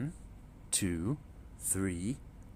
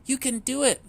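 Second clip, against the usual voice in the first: high, louder reassurance; encouraging mother duck; 13 semitones higher, 6 dB louder, faster than usual. A narrator's reading. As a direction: cheer the little ones on. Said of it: "Mama said".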